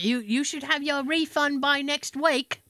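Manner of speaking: slow mock-British woman; put-on British call-centre voice; horrible British accent. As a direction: promise it in a drawn-out bad British accent. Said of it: "I do a horrible British accent".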